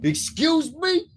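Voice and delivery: high, loud indignant yell. Acted. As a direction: yell it like an outraged janitor. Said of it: "yelled Mister Norris".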